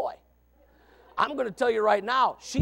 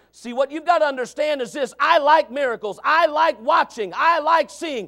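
loud, raised, rhythmic declarations; forceful preaching; 8 semitones higher, 10 dB louder than usual. Real, not acted. Preacher explaining his love of miracles, forceful preaching. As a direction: drive each repeated phrase harder.

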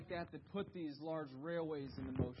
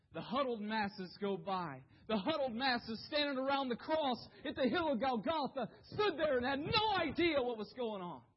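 louder, higher preaching; preaching build; 7 semitones higher, 6 dB louder than usual. Acted. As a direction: press the point with force.

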